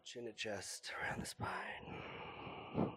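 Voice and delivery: slow soft whisper. Real, not acted. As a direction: breathe the words out in a whisper. Whispered exhale cue.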